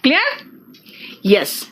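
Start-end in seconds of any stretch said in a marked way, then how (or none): none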